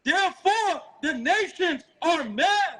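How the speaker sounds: high, slow, ringing scripture reading; street-preaching Bible reader; scripture declamation. Acted.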